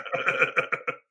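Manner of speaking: loud burst of real laughter. Real, not acted. Speaker reacting to the wife joke, laughing.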